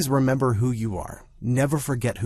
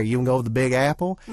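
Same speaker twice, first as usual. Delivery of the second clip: fast, Southern-tinged attempt at a New Yorker; mock New Yorker with Southern accent showing through; 3 dB louder, faster than usual. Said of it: "I'm from New York, I swear".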